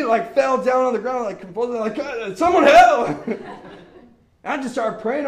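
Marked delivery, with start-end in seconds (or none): 1.9-3.3 high, loud, drawn-out cry for help; hammed-up scream for backup; mock panic. Teen counselor over convulsing boy, panicked shout.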